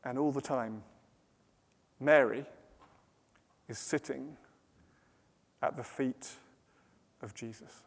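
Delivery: very soft, low, with long pauses; serene hush. A narrator's reading. Serene refrain against Martha's frantic rush.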